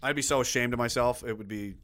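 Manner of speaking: ordinary talk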